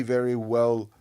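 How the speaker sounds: ordinary talk, nothing marked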